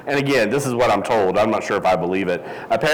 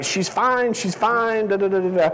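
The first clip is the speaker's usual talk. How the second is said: lightly voiced parents hushing him, pitched up; soothing parents; 9 semitones higher than usual. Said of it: "My parents are trying to say".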